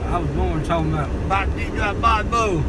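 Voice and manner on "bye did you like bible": country accent. Shown as a demonstration. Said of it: "I've always talked like this".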